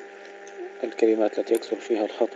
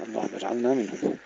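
normal talking voice

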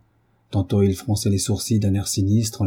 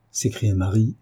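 ordinary delivery

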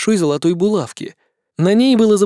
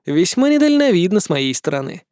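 ordinary delivery

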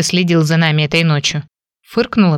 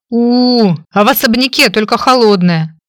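louder, sulky whimper; hurt sob; 5 semitones higher, 4 dB louder than usual. Acted. Heroine complains to the man with a hurt sob. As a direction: whine it like a hurt child, sniffling. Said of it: "обиженно всхлипнула".

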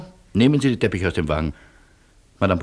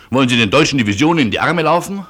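loud, fast, higher outburst; a louder, faster, higher protest; angry protest; 6 semitones higher, 7 dB louder, faster than usual. Acted. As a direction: hurl the question at him in alarm. Argues against the colonel's route.

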